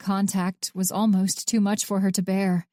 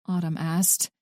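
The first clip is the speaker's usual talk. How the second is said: usual speaking voice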